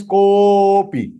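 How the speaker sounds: kopi with an extremely long, level "ko"; five-beat extension. Shown as a demonstration. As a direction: hold the o of kopi as long as five beats, then fall on "pi". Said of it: "Five times".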